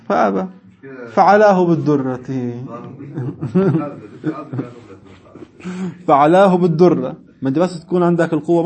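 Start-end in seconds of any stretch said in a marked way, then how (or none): 2.2-3.9 quiet, low chuckle; soft laugh. Real, not acted. Teacher amused at the struck merchant, soft chuckle. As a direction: chuckle softly to yourself.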